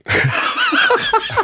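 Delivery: real laugh breaking out high; high burst of laughter. Real, not acted. Laughing at the volcano song joke.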